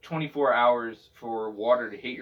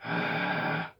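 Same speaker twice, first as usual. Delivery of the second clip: building sigh; 3 dB quieter than usual. Shown as a demonstration. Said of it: "try to build up that".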